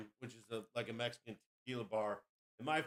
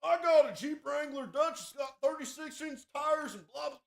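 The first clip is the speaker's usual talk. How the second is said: high, loud, shouting mock bro voice; mocking Jeep owner; 13 semitones higher, 8 dB louder than usual. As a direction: ham up a bragging Jeep driver. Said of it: "there's a hot chick in it".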